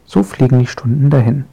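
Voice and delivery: ordinary delivery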